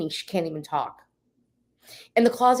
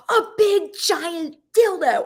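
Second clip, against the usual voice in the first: high, slow, drawn-out blurt; mimicking a wife shouting, high and laughing; imitated yell; 9 semitones higher, 5 dB louder, slower than usual. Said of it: "she yells it".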